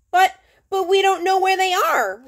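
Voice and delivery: put-on boy stutters in protest to the officer; nervous stutter. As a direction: stammer it out like a panicky kid. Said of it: "Ralphie stuttered".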